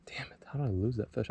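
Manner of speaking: fast, hushed curse under the breath; real frustrated whisper. Real, not acted. Angler whispers at himself after losing a bedding bass, fast and hushed. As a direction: whisper it so the fish won't spook. Fish just popped off.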